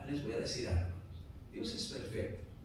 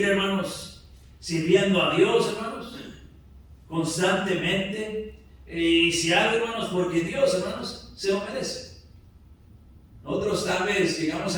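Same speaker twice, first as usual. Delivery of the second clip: loud, high-pitched declaration to the congregation; full-force preaching; 4 semitones higher, 9 dB louder than usual. Real, not acted. Pastor assuring the congregation of God's care, full-force preaching. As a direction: proclaim it loudly from the pulpit.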